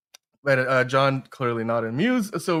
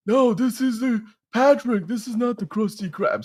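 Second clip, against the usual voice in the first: Patrick Star; 8 semitones higher than usual. Pretend. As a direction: play dopey Patrick Star lightly. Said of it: "How are you doing, Patrick?".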